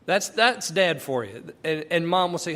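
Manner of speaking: ordinary talk, nothing marked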